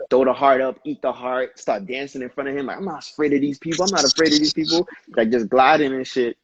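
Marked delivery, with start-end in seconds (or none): 3.8-4.8 louder and faster the second time; rising mock defiance; defiant repeat. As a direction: push the line harder, fired up. Quoting a dancer's defiance.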